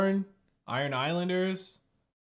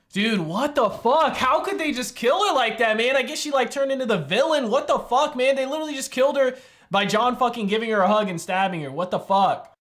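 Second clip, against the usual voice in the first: higher, louder, indignant; outraged rant; 9 semitones higher, 7 dB louder than usual. Real, not acted. Viewer outraged at how she was killed, loud indignant rant.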